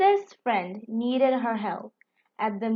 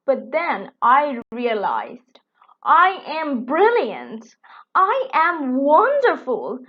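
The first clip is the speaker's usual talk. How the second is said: proud beetle boasting; 6 dB louder than usual. Acted. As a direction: puff up louder with each claim.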